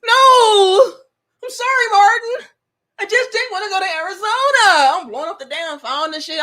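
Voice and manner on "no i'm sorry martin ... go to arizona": loud, high-pitched apology; hammed-up desperate begging; panicked pleading. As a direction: plead like he's walking out on you. Acting out panicked phone plea.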